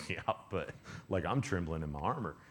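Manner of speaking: low, soft big-guy voice; hulky guy. Retold or imitated. Hulky soldier admitting fear to the little guy, low hulky voice. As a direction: play a hulking soldier confessing fear, low and quiet. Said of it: "like, hulky type".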